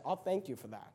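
a lightly voiced God promising reward, soft and low; gentle God voice. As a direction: reassure them quietly as God would. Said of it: "then God says".

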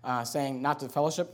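a hint of a skeptical churchgoer, low and soft; doubtful listener. Congregant questioning the pastor, a lightly voiced skeptic. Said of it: "and you say".

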